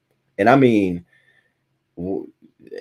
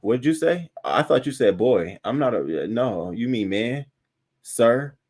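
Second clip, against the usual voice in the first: offended retort; 5 semitones higher than usual. Retold or imitated. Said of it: "I'm like".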